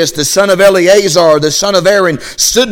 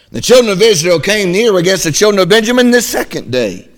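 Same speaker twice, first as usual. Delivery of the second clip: ordinary delivery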